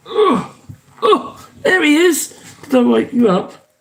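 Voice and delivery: gushing pet talk, high and sing-song. Real, not acted. Waking her pet to say hello.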